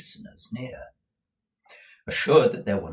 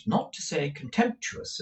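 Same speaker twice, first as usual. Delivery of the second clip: plain reading voice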